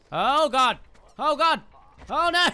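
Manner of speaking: high, loud cries of alarm; panicked yelps. Real, not acted. Player caught by enemies in the game, panicked.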